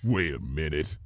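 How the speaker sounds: low soft growl. A narrator's reading. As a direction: growl it suspiciously. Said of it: "growled Spike".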